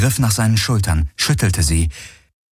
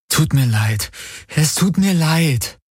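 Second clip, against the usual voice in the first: soothing apology, rising in pitch; gentle apology; 8 semitones higher, slower than usual. A narrator's reading. Kirai soothes the frightened animal, gently apologising. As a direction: apologise as tenderly as you can. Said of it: "so sanft sie konnte".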